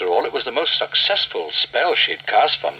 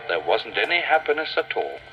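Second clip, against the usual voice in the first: ordinary delivery, nothing marked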